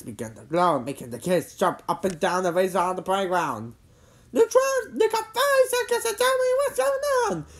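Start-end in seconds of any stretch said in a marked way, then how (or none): 4.3-7.5 high, loud demand; demanding girl. A narrator's reading. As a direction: issue an ultimatum in a very high voice. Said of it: "Cindy demanded".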